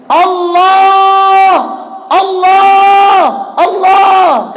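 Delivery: high, loud desperate cry to God; child crying out. Retold or imitated. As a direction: cry out in panic while running. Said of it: "aku hanya bisa berucap". (teriakan anak kecil yang ketakutan)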